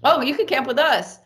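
high-pitched, cheery offer; retold friendly invitation. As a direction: act out a stranger offering a spot.

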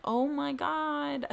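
high, slow, drawn-out disbelief; exasperated oh my god. Retold or imitated. New mom to third nurse asking why she cries, exasperated. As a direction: stretch it out like you've had enough. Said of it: "And I'm like".

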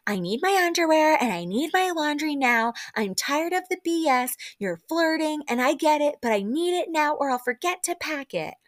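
high-pitched demanding impression, pushed up throughout; Ramona. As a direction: whine out demands in a put-on high voice. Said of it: "like, Ramona classic".

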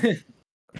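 a sudden crack up in pitch. Real, not acted. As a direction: voice cracks high on oh. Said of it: "my voice just cracked".

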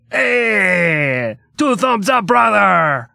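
put-on loud, high Russian-accented shout; Russian Zangief shout. Joking about German versus Russian, a shouted Russian cheer. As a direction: bellow it like a hammed-up Russian wrestler. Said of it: "That's Russian".

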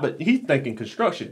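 normal talking voice

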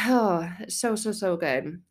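usual speaking voice

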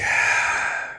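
long breathy exhale; heavy sigh. Real, not acted. Father unsure what to tell his son, sighing. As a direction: let out a real, pondering sigh.

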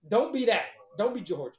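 ordinary talk, nothing marked

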